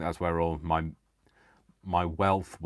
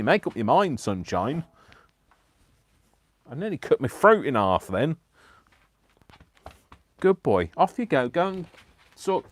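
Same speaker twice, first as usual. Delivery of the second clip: chiding the dog; 9 semitones higher, 8 dB louder than usual. Real, not acted. Telling the dog off, sending it out.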